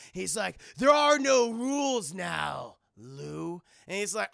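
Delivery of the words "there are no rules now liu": slow commanding shout. Acted. Mentor shouting his decree at Liu Kang, slow loud shout. Acting Nightwolf's stern line.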